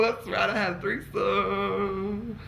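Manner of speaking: giddy repetition. Real, not acted.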